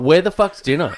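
gruff South African husband voice; South African accent. Acted. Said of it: "You nailed that".

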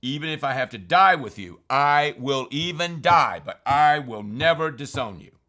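loud, firm protest pitched high; emphatic insistence. Retold or imitated. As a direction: insist with full force, no doubt allowed. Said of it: "Peter insisted emphatically".

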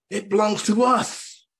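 high, slow declaration; mock possessive claim. People claiming the landed alien, high drawn-out possessive cry. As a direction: stretch it out high, laying claim.

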